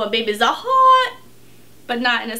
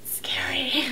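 whisper; 7 dB quieter than usual. Acted. Spooky aside after "so scary.

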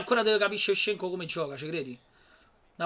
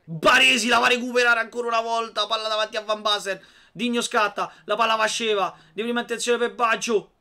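lively sports commentary, raised; play-by-play commentary; 5 semitones higher, 6 dB louder than usual. Real, not acted. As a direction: call the action as it happens. Live play-by-play of own match.

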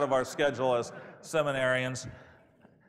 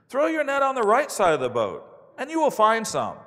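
calling out instructions; 7 semitones higher, 6 dB louder than usual. A narrator's reading. Jesus' shouted instruction from shore.

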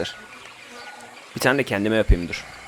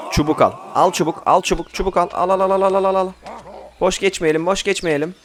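rapid repeated higher-pitched call; urgent playful chant, pitched up; vendor-style calling out; 5 semitones higher than usual. Pretend. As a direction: rattle it off like a hawker rallying takers. Hawking sticks to his group.

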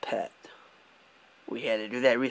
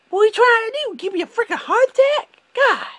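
much higher and louder, spooked and indignant; startled outburst; 7 dB louder, faster than usual. Retold or imitated. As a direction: squeal it like your heart just jumped. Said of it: "It's like".

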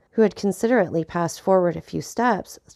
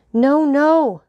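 loud, high outburst of protest; impassioned, raised-voice objection; distressed cry; 9 semitones higher, 7 dB louder than usual. A narrator's reading. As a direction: cry out, unable to hold your feelings back. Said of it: "cried Maximilian, quite unable to restrain his feelings".

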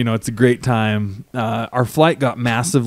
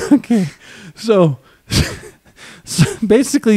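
high, still laughing; 9 semitones higher than usual. Real, not acted. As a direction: settle down laughing. Recovering from laughter.

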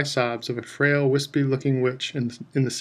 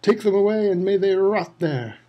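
higher, louder hateful roar; roaring curse; 7 semitones higher, 4 dB louder than usual. A narrator's reading. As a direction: curse them as they go. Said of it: "Crouch roared".

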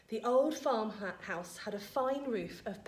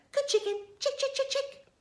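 very high clucky bird call; coaxing. A narrator's reading. George luring the hen to taste medicine, coaxing. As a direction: coax it in a high, quick patter.

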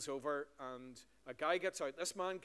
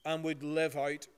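slow, louder prayer voice, reverent; public prayer; 5 dB louder than usual. Acted. Minister praying aloud before the congregation, slow and reverent. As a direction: lead the church in prayer, slow and strong.

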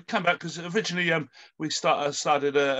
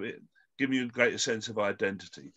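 ordinary talk

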